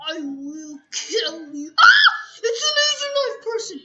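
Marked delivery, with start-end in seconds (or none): 1.8-3.8 high, fast laughing scream; laughing shriek. Real, not acted.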